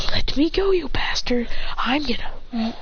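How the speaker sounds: hissed rage; enraged boy straining against his friend; furious whisper. Acted. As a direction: seethe it under the breath.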